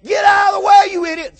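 acted road-rage outburst, loud attack; mad driver's yell. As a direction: holler furiously at someone blocking the road. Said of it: "Somebody's so mad".

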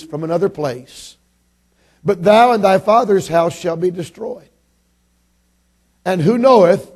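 ringing King James Bible reading; scripture reading. Real, not acted. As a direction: read the verse out to the whole congregation, measured and loud. Reads scripture aloud to congregation.

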